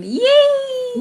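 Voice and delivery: high, bright squeal of joy; excited cheer. Real, not acted. Host cheering to viewers about nearly reaching subscribers, excited squeal. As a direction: cheer the almost-reached goal.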